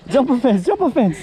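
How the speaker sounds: excited calling. Real, not acted. Echoing laughingly while hauling.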